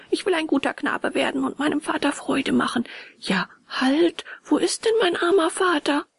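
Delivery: put-on high boyish pleading; earnest puppet boy. A narrator's reading. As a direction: voice a little puppet promising to be good.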